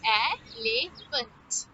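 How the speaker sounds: "Elephant" in three separate beats; syllable by syllable. Shown as a demonstration. Teacher claps word with children, "Elephant" in three beats. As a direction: say El, e, phant with pauses between. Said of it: "How many syllables did you hear?".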